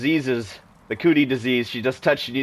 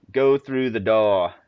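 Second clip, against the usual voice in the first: slow, put-on British accent; drawn-out British reading, the r dropped in "door"; 2 semitones lower, slower than usual. Player voicing a game prompt in an old British house, British accent. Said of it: "I'm assuming he's British".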